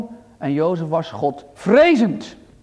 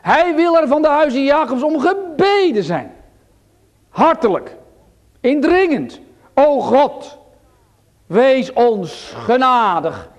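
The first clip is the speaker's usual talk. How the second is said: loud, high, pleading preacher; fervent preaching; 6 semitones higher, 5 dB louder than usual. Real, not acted. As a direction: cry it out to the congregation, urging. Preaching at full force. (vurig preken)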